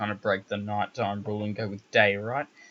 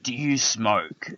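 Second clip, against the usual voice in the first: repeating the stranger; 4 dB louder, much slower than usual. Retold or imitated. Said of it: "he said".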